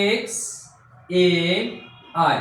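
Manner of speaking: "এক্স", "এম", "আই", each alone between pauses; split into syllables. Shown as a demonstration. Teacher counting out syllables for students, "এক্স", "এম", "আই" said apart. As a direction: separate beats for "এক্স", "এম", "আই". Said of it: "তাহলে প্রথমে আমরা কি করি?".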